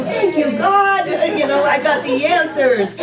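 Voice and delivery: mock blissful thanks. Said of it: "flow right through me and go".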